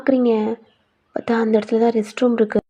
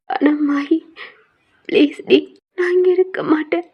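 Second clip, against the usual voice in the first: whispered, higher begging; hushed plea; 5 semitones higher than usual. Acted. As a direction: whisper it, desperate to get out. Heroine secretly begs to leave.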